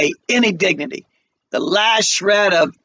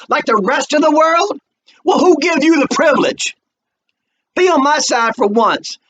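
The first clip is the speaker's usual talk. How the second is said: much higher, loud reproach; stern rebuke; 10 semitones higher, 4 dB louder than usual. Acted. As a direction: scold her for giving up on him. Mama rebukes daughter.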